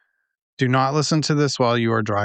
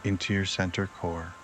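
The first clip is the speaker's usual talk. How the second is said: guided meditation; 3 semitones lower, 7 dB quieter than usual. Acted. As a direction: ease them down, softer and lower than everyday talk.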